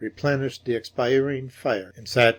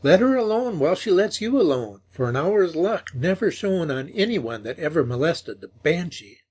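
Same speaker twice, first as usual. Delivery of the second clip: put-on pleading old woman; 7 semitones higher, 4 dB louder than usual. A narrator's reading. Old woman begging him stay.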